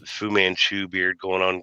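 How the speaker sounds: ordinary delivery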